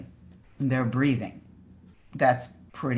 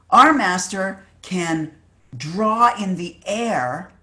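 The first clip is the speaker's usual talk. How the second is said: loud, slow boast, pitched up; yelling across; 5 semitones higher, 7 dB louder, much slower than usual. Retold or imitated. Rival student bragging about his master's miracle, yelling across. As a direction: brag across the water, stretching the words. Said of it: "yells across and says".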